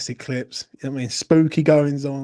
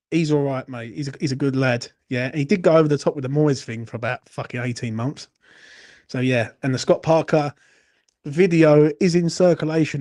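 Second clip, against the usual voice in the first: ordinary talk, nothing marked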